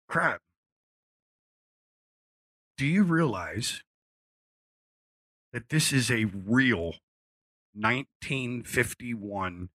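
slow, drawn-out awe at the price; awed reveal. Retold or imitated. Producer telling the singer the guitar's worth, slow and awed. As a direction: spell out the value slowly.

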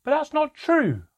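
high, loud shout of protest; outraged cry. A narrator's reading. Child protests at what the elf reports, crying out. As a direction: cry out in indignant denial. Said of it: "I cried".

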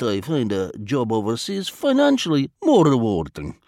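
put-on lightly accented, formal and unhurried Vladimir; a polite spy choosing words with care; foreign diplomat voice. A narrator's reading. Vladimir's long explanation voiced.

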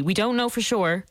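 usual speaking voice

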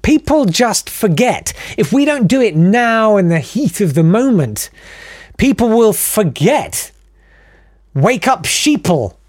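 a touch of soapbox fervour; mock rallying cry. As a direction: rouse the crowd with mock urgency. Mock conspiracy warning to the audience.

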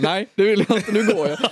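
laughing through a mock brush-off. Retelling own quip on the class trip, laughing. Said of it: "Eller jag bara".

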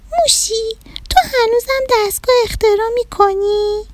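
put-on high, soft childlike rabbit voice; little rabbit. Little rabbit telling the mouse, high sad child voice.

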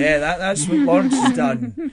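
a hammed-up mother covering a lie; mock flustered mum. Mum's excuse acted high.

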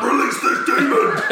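high, loud possessed shriek, laughing; exorcist demon voice. Retold or imitated. Imitating his wife possessed by a demon in labour. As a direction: screech it like a possessed woman in labour. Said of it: "like the fucking exorcist".

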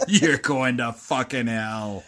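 slow mock evil gloat; hammed-up villain voice, laughing. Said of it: "that was my best, like, evil voice there".

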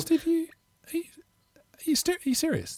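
high, halting disbelief at a gift; touched surprise. Real, not acted. Host stunned when the guest offers to buy him hangers, high surprise. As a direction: caught off guard, pitch jumping up.

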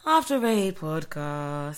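slow, drawn-out low delivery of the show name; going low. Shown as a demonstration. Co-host rehearsing the intro the wrong way, low and slow. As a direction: take the podcast name down low, stretching it. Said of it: "You are going low".

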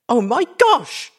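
very high, loud, drawn-out squeal; excited filly squealing with delight. A narrator's reading. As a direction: squeal it like an overjoyed child. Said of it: "squealing in delight".

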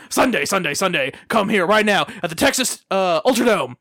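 monster truck ad. Pretend. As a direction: yell it like a monster truck commercial.

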